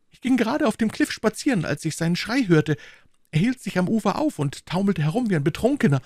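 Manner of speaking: high, loud, breathless agitated man; a frightened headmaster urging Holmes on; desperate plea for help; agitated Stackhurst. A narrator's reading.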